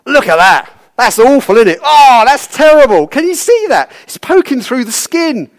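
loud, high, hammed-up horror; mock-horrified outburst, pitch soaring; appalled exclaiming. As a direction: gawp aloud at a gruesome sight for your colleagues' benefit. Acted doctor exclaiming to other doctors.

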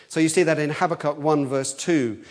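ordinary talk

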